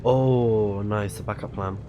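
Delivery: usual speaking voice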